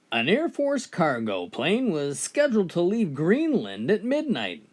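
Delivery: ordinary talk, nothing marked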